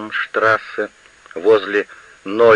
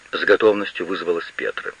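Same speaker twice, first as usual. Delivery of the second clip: ordinary delivery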